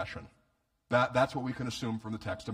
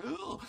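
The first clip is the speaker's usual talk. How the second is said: high, soft squeal of disgust; acted high-pitched groan of revulsion; disgusted "ugh"; 6 dB quieter than usual. Guests seeing the woman kiss his feet, disgusted.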